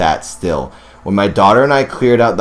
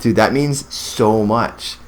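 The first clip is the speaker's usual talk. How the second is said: normal talking voice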